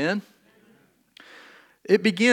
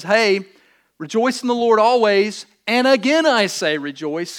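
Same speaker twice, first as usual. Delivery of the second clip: raised, louder proclamation; loud call to the back; 4 semitones higher, 6 dB louder than usual. Shown as a demonstration. Preacher calls out loudly to those in the back. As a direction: call it out so the back rows hear. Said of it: "for those in the back".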